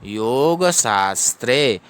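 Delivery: slow "योग शास्त्रे" as yo-ga shaas-tre; correct reading. Shown as a demonstration. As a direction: stretch the vowels of "योग शास्त्रे". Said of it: "ऐसे पढ़ेंगे".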